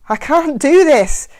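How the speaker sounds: loud, slow, vehement refusal. Acted. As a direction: push back hard and loud. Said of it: "he said louder and more vehemently".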